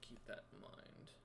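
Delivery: whispered note to self; quiet murmur. Real, not acted. Game master noting something to keep in mind, whispering. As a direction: murmur it almost without voice.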